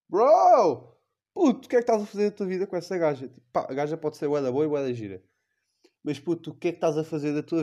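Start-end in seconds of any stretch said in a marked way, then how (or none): 0.1-3.3 loud, high, fast scolding; exasperated shout. Real, not acted. Comic scolding the cheated boyfriend, shouting. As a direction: yell at him in disbelief.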